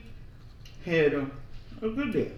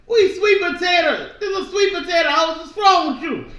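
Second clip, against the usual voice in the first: mock protest shout; 7 semitones higher, 5 dB louder than usual. Narrator scolding the pumpkin pie eater, loud and high. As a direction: shout it at a friend gone astray.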